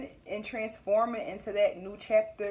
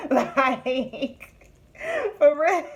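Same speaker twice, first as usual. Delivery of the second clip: real laughter bursting through, louder and higher; laughing hard; 5 semitones higher, 6 dB louder, much slower than usual. Real, not acted. Vlogger cracking up at her own story, laughing hard. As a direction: crack up hard while talking. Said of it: "I don't know why I'm laughing so hard".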